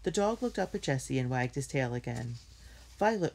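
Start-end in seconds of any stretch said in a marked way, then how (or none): 3.0-3.3 higher-pitched call to give an order. A narrator's reading. Jessie sends Violet to wet a handkerchief, ordering. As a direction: call the name as a mild command. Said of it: "ordered Jessie".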